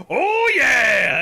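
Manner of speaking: high, loud laughing "Oh, yeah!" impression; imitating Manly Dan shout. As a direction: belt it out like the lumberjack dad. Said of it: "and he has".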